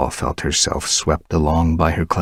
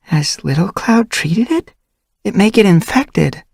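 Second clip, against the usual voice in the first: put-on worried young she-cat; 5 dB louder than usual. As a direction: fuss over his wound. Dovepaw worried.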